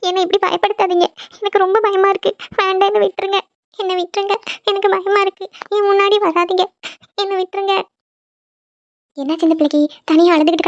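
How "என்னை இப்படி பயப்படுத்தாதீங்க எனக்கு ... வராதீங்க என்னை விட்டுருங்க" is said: put-on girl begging the ghost to leave her alone; terrified begging. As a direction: beg for mercy with a breaking voice.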